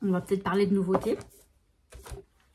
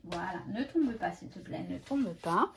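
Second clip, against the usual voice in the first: hushed plea; 11 dB quieter than usual. Real, not acted. Pleading with a sticker book not to fall.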